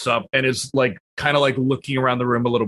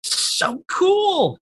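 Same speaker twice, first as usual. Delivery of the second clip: gushing fascination; 10 semitones higher than usual. Retold or imitated. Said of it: "and it's like".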